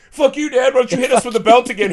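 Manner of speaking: high, loud, fast imitation of angry kids; hammed-up yelling at a dad; mock angry outburst. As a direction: lash out like a fed-up kid, exaggerated. Kids confronting dad, retold.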